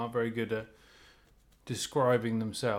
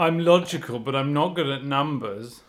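loud mock summary of someone else; teasing imitation, pushed loud; mock boast; 7 dB louder than usual. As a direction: parody their boring answer, louder. Mockingly voices the other's boring list.

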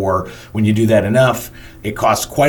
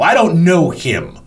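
higher-pitched, slow mock indignation; dismissive retort; 7 semitones higher, 3 dB louder, slower than usual. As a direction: imitate an entitled complainer, drawn out. Retold angry woman dismisses boss.

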